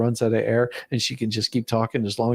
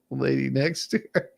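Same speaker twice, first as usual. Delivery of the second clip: ordinary talk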